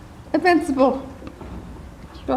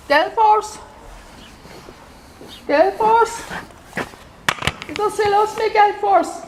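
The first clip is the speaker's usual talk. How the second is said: raised, carrying call to a horse; 4 semitones higher than usual. Real, not acted. Horse won't say hello before a long absence, calling out. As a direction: call out insistently, pitch held high.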